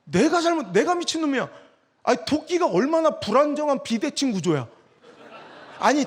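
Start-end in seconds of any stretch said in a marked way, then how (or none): none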